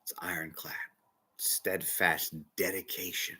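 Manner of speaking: hushed, awed whisper. Real, not acted. Preacher marvelling at steadfast dedication, hushed whisper. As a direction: breathe it like a sacred vow. Awed pause about keeping his word.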